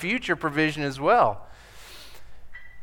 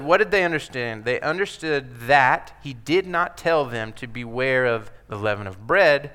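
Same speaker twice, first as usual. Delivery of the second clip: ordinary delivery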